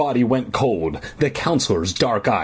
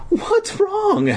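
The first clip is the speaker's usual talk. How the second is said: very high-pitched acted demand. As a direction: demand an answer in a high squeal. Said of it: "Jan demanded".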